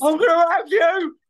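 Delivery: hammed-up loud, high threat; lipless road rage. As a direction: threaten with a twisted, lipless mouth. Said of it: "you lose your lips".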